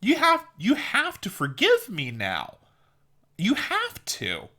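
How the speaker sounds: high, slow, put-upon whine; indignant mock apologizer. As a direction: play the wounded offender demanding forgiveness. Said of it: "it's like".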